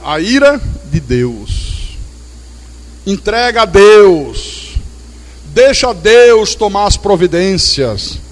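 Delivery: slow, weighty sermon delivery; solemn measured preaching. Acted. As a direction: let each phrase land slowly.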